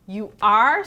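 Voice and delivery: put-on loud, incredulous child's reaction; astonished question. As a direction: blurt it out in disbelief. Classmate astonished by teacher's revelation.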